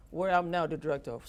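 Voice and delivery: ordinary talk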